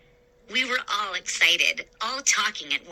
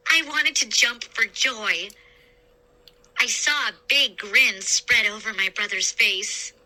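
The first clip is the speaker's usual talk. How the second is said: ordinary delivery, nothing marked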